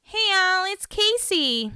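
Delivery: high, slow imitated phone greeting; Casey. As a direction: put on a high drawn-out hello. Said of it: "Casey's call to Alex. She goes".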